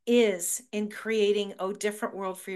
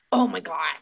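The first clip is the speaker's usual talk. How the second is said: a low, louder, fed-up groan of a line; exasperated mom; 6 semitones lower, 5 dB louder than usual. Retold or imitated. Mom fed up with her daughter's teasing, low exasperated groan. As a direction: play the mom rolling her eyes at her daughter.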